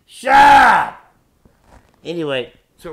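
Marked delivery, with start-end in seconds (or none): 0.1-1.1 explosive high-pitched yell; very loud, abrupt outburst; sudden shout. Real, not acted. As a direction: yell it at full volume to cut someone off. Cuts off own rambling with yell.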